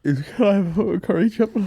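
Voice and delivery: high mock-tearful order; imitating a customer holding back tears; teary. Retelling the crying man ordering at the zoo cafe, tearful. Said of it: "He was, like, all teary-eyed".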